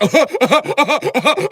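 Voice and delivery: high, loud silly cackle; shrill mocking laugh of the chatters. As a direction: cackle like a gleeful troll. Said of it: "they was like".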